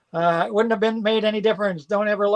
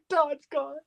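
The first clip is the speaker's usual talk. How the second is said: Todd's gone laughed out, high and airy; laughing; 9 semitones higher, 6 dB quieter than usual. Real, not acted. Seller to viewers, giving up the Todd box, laughing. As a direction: laugh it off in mock grief.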